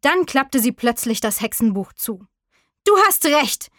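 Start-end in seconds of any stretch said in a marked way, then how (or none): 2.8-3.7 high-pitched angry little witch; angry call. A narrator's reading. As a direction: snap back crossly, pitched high. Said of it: "rief sie zornig". (zornig gerufen, mit hoher Stimme)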